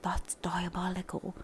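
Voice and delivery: put-on a hushed, lightly done British accent on "That's diabolical"; whispered British accent. Imitating the British guy from The Boys, hushed British accent. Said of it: "probably a terrible British accent".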